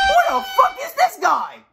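freaked-out yell; shocked shriek. Real, not acted. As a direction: recoil at the monster. Monster appears on screen.

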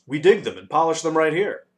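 high, loud, eager explanation; volunteering. A narrator's reading. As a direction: chime in keenly. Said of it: "volunteered the dodecahedron".

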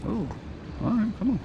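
ordinary talk, nothing marked

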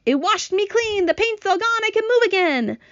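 high-pitched, elated exclaiming; excited centipede. A narrator's reading. Centipede washed clean telling the others, high excited cries. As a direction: cry it out, high and thrilled. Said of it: "shouted the centipede excitedly".

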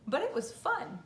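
ordinary delivery, nothing marked

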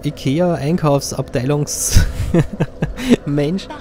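slow words through laughter; laughing at own joke. Real, not acted. Joking, laughing while speaking.

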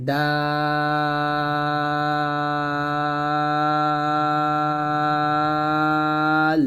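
"দাল" with the vowel held steady for four counts; four-beat madd. Shown as a demonstration. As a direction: draw out "দাল" long and even. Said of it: "দালের চার লিফ টান দিব". ("দাল" চার আলিফ লম্বা টেনে)

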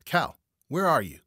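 loud, high call through the woods; searching shout. A narrator's reading. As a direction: shout for your missing partner. Calling for missing partner in woods.

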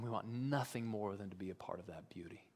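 soft and low, whispered; awed whisper to the congregation; hushed whisper. Real, not acted. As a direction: pull the room in close. Awe after the climax.

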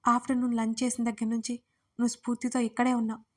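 ordinary delivery

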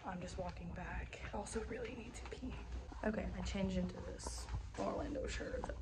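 soft unvoiced whisper. Real, not acted. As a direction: whisper so nobody hears. Whispering while walking back.

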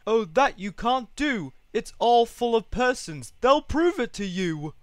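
loud, high, urgent objection; aghast elephant defending friends; alarmed protest. A narrator's reading. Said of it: "gasped Horton".

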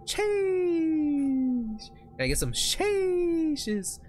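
high, slow, drawn-out silly plea. Acted. High drawn begging chat.

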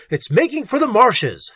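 high-pitched warning call; urgent shout. A narrator's reading. Little tracking the fleeing creature, shouting. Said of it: "shouted Little".

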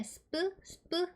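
스프 as a short English "sp" with barely any vowel; sp blend sound. Shown as a demonstration. Teacher sounding out S and P for learners, 스프 as short sp. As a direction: give 스프 twice, short and separate. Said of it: "이렇게 발음하시죠".

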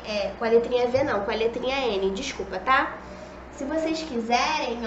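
usual speaking voice

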